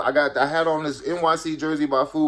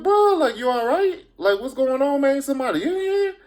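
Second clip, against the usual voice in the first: much higher, worried, anxious question; alarmed concern; 12 semitones higher than usual. Retold or imitated. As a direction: nervously check on a spooked friend. Asking scared friend what's wrong.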